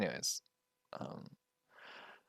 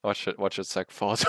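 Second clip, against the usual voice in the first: ordinary delivery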